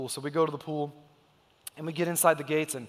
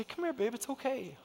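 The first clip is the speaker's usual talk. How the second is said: softer, tender reassurance; comforting dad; 6 semitones higher, 6 dB quieter than usual. Retold or imitated. Dad comforting his daughter with bloody knees, soft and tender. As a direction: soothe a hurt little girl.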